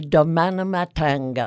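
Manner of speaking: "demain matin" in Provençal, each word ending in a twangy -ng; Provençal accent. Shown as a demonstration. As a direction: add a Provençal flip to the ends of "demain matin". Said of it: "flip at the end of each word".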